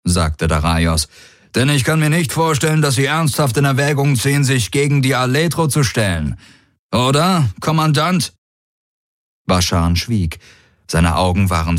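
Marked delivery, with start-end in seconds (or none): 1.5-8.3 higher, fast, smug warlord; taunting warlord. Acted. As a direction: needle him with polite menace. Daraios taunts Bashan.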